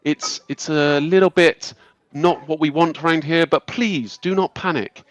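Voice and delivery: high, measured voice of a graceful elf leader; calm high elf lady. Acted. Elf lady calming the angry townsfolk, high and measured. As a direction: soothe the crowd with calm authority. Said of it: "this high elf lady".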